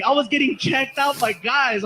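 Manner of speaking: ordinary talk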